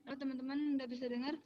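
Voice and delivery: suara lirih lewat mikrofon lemah; barely audible. Real, not acted. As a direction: hampir tak terdengar. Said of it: "Masih kecil". (suara kecil sekali)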